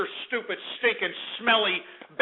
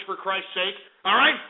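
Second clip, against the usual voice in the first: normal talking voice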